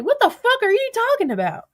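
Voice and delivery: high-pitched mock incredulity; squawked, exaggerated disbelief; imagined baffled partner. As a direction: act out a bewildered baby daddy, voice way up. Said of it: "Do you know what he would say?".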